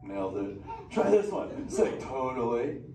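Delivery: a hyped, easygoing beach dude of a turtle; Crush the sea turtle. Acted. Sea turtle greeting the kids in the theater, stoked surfer-dude voice.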